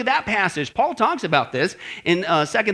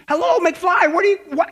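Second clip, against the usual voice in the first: high, forceful incredulous scolding; mock exasperation; 8 semitones higher, 3 dB louder than usual. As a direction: pitch it up and badger them. Acted scolding of gullible believers.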